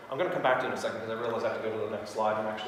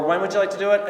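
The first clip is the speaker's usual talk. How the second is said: confident, taking the yes for granted; host asked to pick a date, confidently assumptive; assumptive close; 6 semitones higher, 7 dB louder than usual. Shown as a demonstration. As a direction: ask when, not if, with easy confidence.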